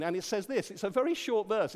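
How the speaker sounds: normal talking voice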